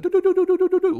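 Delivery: high, rapid run of doo-doo-doos; rapid "doo-doo-doo" sound effect. Retold or imitated. Vlogger showing the price tumbling after the sell-off, high rapid doo-doo noise. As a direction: mimic a falling chart with a string of doos. Mimics price sliding all the way down.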